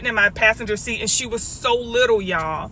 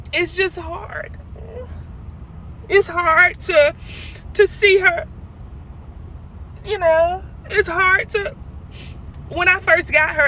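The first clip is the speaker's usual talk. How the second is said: choked up; 7 semitones higher than usual. Real, not acted. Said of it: "I'm so emotional".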